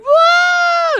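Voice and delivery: loud, very high party whoop. Retold or imitated. Queen on a stranger's shoulders, loud high whoop. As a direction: scream with joy on the crowd.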